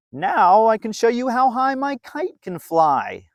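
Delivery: put-on a proud kid showing off his kite; a hint of an eager boy; Bill's voice. Bill boasts about his kite.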